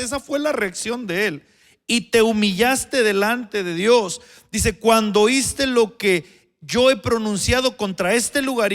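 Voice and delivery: leer fuerte y más agudo, despacio; lectura bíblica enfática. Acted. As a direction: proclama cada versículo. Reads verses with force. (proclamación con fervor)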